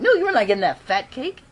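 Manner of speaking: high-pitched mock telling-off; acted refusal. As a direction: play Sam warning him off the cake. Acts Sam refusing Gibby the cake.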